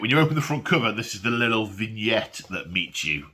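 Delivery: usual speaking voice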